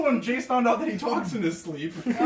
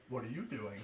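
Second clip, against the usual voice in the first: "What are you doing?" low and much softer, dazed; groggy half-awake; 11 semitones lower, 17 dB quieter than usual. Retold or imitated. Sleepwalker coming to, asking softly and groggily. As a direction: mumble it as if just waking. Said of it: "Jason's like".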